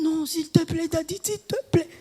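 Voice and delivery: high, soft mock whimpering plea; preacher mimicking the girl begging Daddy; pleading crying girl. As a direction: whine it like a sulking girl. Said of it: "Il dit".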